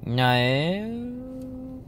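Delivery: high, stretched "no" sliding upward; drawn-out incredulous no. Retold or imitated. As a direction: squeak out a long disbelieving no. Reply to an anti-Russian rant.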